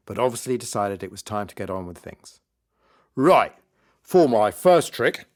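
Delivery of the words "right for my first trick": loud, slow showman's announcement; stage magician. Acted. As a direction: announce it grandly to the party crowd. Magician announces his trick on stage.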